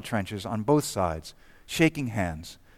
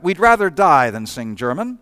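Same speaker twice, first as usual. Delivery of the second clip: louder, defiant retort across the trench; mock shout back; 3 semitones higher, 8 dB louder than usual. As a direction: throw it back loud and cheeky. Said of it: "A Brit shouted back".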